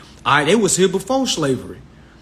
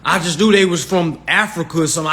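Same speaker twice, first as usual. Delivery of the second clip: ordinary talk, nothing marked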